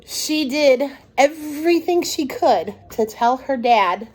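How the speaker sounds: plain reading voice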